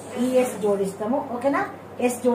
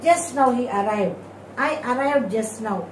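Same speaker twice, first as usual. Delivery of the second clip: slow example read; much slower than usual. Shown as a demonstration. Teacher models example sentences.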